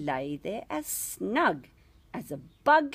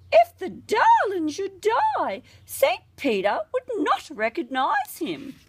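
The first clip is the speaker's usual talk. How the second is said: mother crying out; 9 semitones higher than usual. A narrator's reading. Wife cries out fearing her unchristened boy may die. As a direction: wail it as a fretting mother. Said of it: "his wife used to cry".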